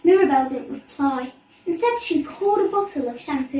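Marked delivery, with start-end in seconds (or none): none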